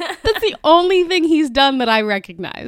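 excited. Real, not acted. Excited recognition continues.

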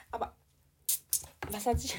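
ordinary talk, nothing marked

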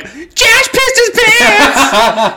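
high, slow, laughing announcement; laughing shout. Retold or imitated. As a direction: crow it out while cracking up. Announcing kid's accident to everyone.

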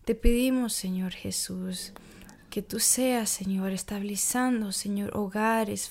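ordinary delivery

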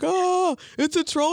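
hammed-up fright; panicked villager. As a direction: shriek it like a fleeing villager. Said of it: "It's like".

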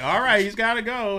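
loud, high, take-charge call; handler's urgent announcement. Retold or imitated. Handler pulling the bird away, loud and urgent. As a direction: call it out over the crowd. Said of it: "he's like".

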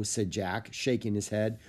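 ordinary delivery

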